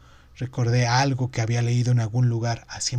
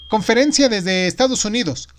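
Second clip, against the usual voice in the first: higher, louder operator-style announcement; a mildly bored call announcement; 9 semitones higher, 7 dB louder than usual. A narrator's reading. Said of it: "la voz aburrida del señor Fayaz". (anuncio con voz aburrida)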